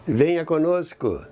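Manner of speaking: ordinary delivery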